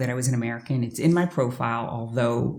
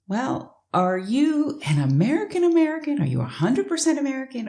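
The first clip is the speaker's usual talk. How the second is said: mocking the guy's question; 12 semitones higher than usual. Mocking the dating match's questions.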